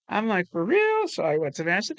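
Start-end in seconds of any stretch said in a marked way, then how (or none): 0.5-1.1 mock disbelief.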